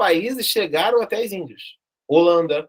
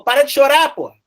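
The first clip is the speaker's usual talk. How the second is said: higher, louder scolding yell; retold yell; 7 semitones higher, 7 dB louder than usual. Teacher acting out yelling at the weeping king, loud. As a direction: snap the crying king out of it.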